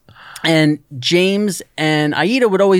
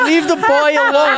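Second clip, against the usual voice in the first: quoting Edie; 9 semitones higher than usual. Retold or imitated.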